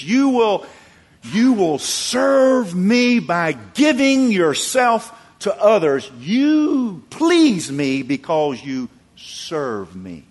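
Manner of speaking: loud, high, drawn-out proclamation; impassioned preaching. Acted. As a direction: preach it at full force, stretching key words. Preacher voices God, building to climax.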